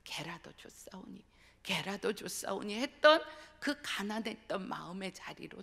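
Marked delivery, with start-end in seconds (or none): 0.0-1.2 soft whispered plea, very quiet; whispered humble plea; hushed prayer. Retold or imitated. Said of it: "그때의 그 기도 자리로 돌아가요".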